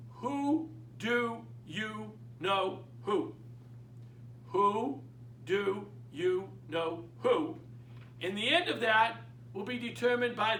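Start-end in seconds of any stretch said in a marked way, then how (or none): 0.1-3.3 very slow, each word of "who do you know who" set apart. Shown as a demonstration. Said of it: "only dialogue you need verbatim". 4.5-7.6 very slow, each word of "who do you know who" set apart. Shown as a demonstration. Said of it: "only dialogue you need verbatim".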